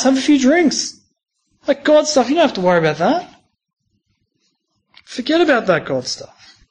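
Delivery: a hint of a scoffing mate; mock taunting. Uni friends teasing Steve about his faith, lightly mocking voice. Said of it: "we get similar taunts".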